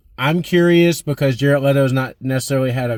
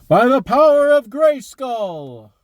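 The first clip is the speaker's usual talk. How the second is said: high, slow heroic proclamation; He-Man battle cry; 12 semitones higher, 4 dB louder, slower than usual. Retold or imitated. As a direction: raise the sword and proclaim it slowly. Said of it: "everybody's waiting for the moment of".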